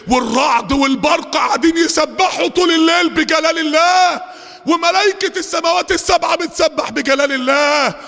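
high, ringing sustained sermon voice; impassioned preaching. Real, not acted. Preacher contrasting praising thunder and angels with deniers, fervent high preaching. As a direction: preach it with mounting fervour.

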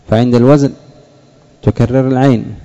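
ordinary talk

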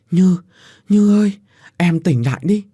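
high, loud call to wake her; desperate calling. A narrator's reading. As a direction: call her name in panic. Phúc calling unconscious Như. (Phúc hoảng hốt gọi Như tỉnh lại)

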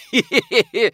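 high, loud tittering laugh; tipsy acted cackle; gleeful giggle. A narrator's reading. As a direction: giggle in a rapid high hee-hee. Friends burst into merry laughter.

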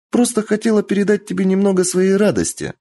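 put-on high, bright playful girl; cheerful girl voice. A narrator's reading. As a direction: sing it out playfully.

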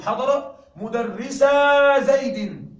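slow, loud example sentence; slow emphatic model sentence; clear example reading. Shown as a demonstration. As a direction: read it slowly and loudly for the class. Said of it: "نحذف النون".

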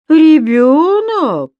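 high, very slow, loud disbelieving echo; a loud, drawn-out incredulous question, pitched high. A narrator's reading. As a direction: stretch out the word in disbelief. Man scoffs at the word child.

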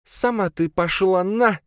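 high, drawn-out angry retort; shouting back. A narrator's reading. Mistress of shadows curses at the wind, shouting back. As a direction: yell the curse back slowly, high-pitched. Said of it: "крикнула в ответ она".

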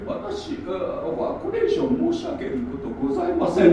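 high-pitched, halting mock official; mock press-conference statement. Mocking her press conference about Cairo University, high-pitched evasive imitation. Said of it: "みたいな感じでさ".

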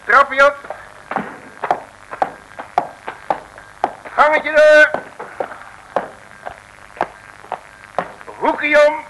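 high, loud step-by-step calls; climbing narration. Acted. Loeres leading Sientje up the stairs, high and loud. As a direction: announce each step like a march.

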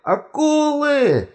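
very high, drawn-out cry; alarmed shout. A narrator's reading. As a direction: shout a warning to everyone aboard. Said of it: "крикнул он". (пронзительный крик тревоги)

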